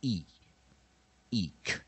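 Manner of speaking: short "E" alone, then closed with k as "eek"; weak-vowel demo. Shown as a demonstration. Teacher drilling weak vowel I, isolated E then eek. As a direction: sound out "E", pause, then "eek". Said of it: "we vowel I".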